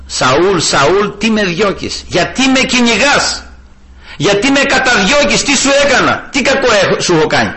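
high, pleading divine voice calling Saul; voice of Christ. Retold or imitated. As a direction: ask Saul why he persecutes, wounded. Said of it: "άκουσε και μια φωνή".

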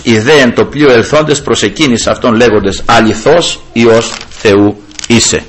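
measured liturgical Gospel reading; scripture reading. Acted. Priest reading the Gospel to the congregation, solemn recitation. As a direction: read the Gospel solemnly to the flock. Reads the Gospel passage aloud.

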